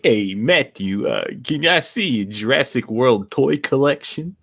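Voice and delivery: slow, drawling character voice; exaggerated accent, words stretched out; put-on accent. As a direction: put on a goofy accent to ask a favor. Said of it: "talk in that accent".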